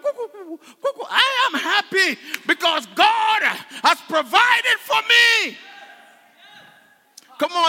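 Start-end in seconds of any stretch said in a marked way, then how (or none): 0.0-1.1 turtle dove cooing. Retold or imitated. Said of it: "I'm gonna turtle dove". 1.1-5.6 high, slow, jubilant preacher's shout; exultant cry. Acted. Voices the happy turtle dove.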